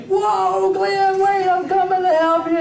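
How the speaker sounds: high-pitched mock yell across the street; high, urgent acted call; calling out a warning. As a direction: holler to stop a friend, drawing out the words. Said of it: "I would be over the other side saying".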